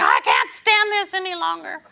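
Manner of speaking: high, loud despairing cry; exasperated wail. Retold or imitated. Believer voicing despair in a high exasperated wail. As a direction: whine it out like someone at the end of their rope. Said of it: "That's the standard thing".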